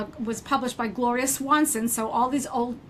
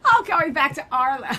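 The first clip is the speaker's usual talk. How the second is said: laughing exclamation; 2 semitones higher, 4 dB louder than usual. Retold or imitated. Recalling meeting her namesake, loud and laughing. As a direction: laugh it off and shout the old name. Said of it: "And I was like".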